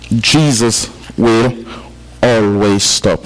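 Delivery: drawn out, every word weighed; slow it right down so it sinks in; slow emphatic repeat. Shown as a demonstration. Preacher repeating the key point to his congregation, slow and weighty. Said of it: "Keynote number one is that Jesus will always stop".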